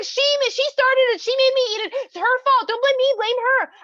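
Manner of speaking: high, loud, whiny protest; frantic blaming. Acted. Man points at the woman before Hashem, frantically blaming.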